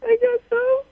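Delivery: small high-pitched crying voice; really weepy, high voice; tearful. Real, not acted. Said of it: "you crying about the situation".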